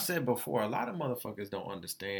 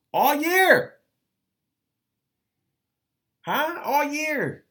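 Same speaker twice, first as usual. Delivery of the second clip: shouting the words out, high and loud; exasperated shout; 11 dB louder, much slower than usual. Real, not acted. Ranting to listeners about a year of rejections, shouting. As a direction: yell it like you can't take another no.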